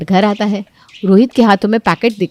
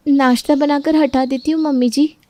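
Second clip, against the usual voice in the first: higher, dutiful reply; meek daughter-in-law; 6 semitones higher than usual. Acted. Daughter-in-law placating mother-in-law, meek.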